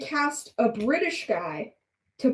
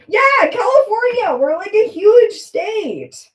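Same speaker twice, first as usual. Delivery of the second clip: excited outburst; 12 semitones higher, 9 dB louder than usual. Real, not acted. Marvelling at how huge California is, loud and excited. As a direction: proclaim it like big news.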